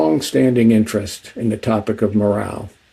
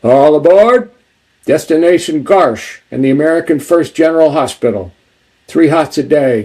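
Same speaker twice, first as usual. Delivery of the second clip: conductor-style call, higher and louder at the start; shouting; 3 semitones higher, 3 dB louder than usual. Acted. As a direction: call it out like "all aboard" to a train car. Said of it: "Mickey turns back and shouts".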